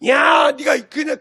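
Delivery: loud, high, drawn-out call-out; accusing shout. Acted. Job's friends lay into him, accusing shout. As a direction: stretch the opening into a scolding yell.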